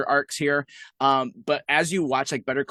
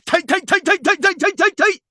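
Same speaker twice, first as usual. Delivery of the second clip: high, loud rapid-fire repetition; hammed-up frenzied chant, pitched way up; manic catchphrase yell; 8 dB louder than usual. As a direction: yelp each word in an even, punchy string. Acted catchphrase chant after the shout.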